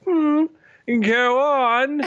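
high, languid, bored royal voice; bored emperor. Acted. Enthroned watcher tells them to continue, bored. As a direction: drawl it from the throne, unimpressed. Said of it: "he looks kinda bored".

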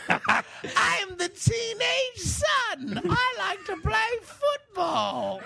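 high, slow, silly squeak; hammed-up squeaky boy, words stretched out; squeaky teenage son. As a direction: play a teenager in an absurd high voice. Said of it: "attending the high squeaky voice convention".

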